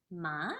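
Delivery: "Ma" gliding upward from low; second tone. Shown as a demonstration. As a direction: lift "Ma" like a question. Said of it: "it's a going up sound like this".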